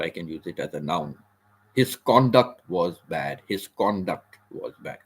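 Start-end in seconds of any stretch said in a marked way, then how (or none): 1.7-5.0 CON in "conduct" stressed, long and full; first-syllable stress. Shown as a demonstration. Teacher demonstrates the noun, first syllable of "conduct" stressed. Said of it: "we stress on the first syllable".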